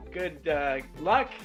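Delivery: normal talking voice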